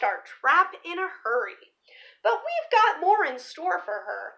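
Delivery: lively picture-book read-aloud; story narration. Acted. Reading a dinosaur picture book to viewers, storybook voice. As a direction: read the rhyming story to the kids.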